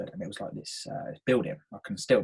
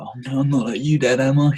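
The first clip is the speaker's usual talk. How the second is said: mock dismay; 4 semitones higher, 9 dB louder than usual. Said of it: "crying after with my dad".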